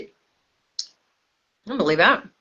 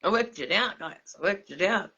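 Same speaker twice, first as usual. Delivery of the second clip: tipsy, slurred words; 8 dB quieter than usual. Real, not acted. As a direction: talk like you've had a few. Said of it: "Sorry, I'm drunk".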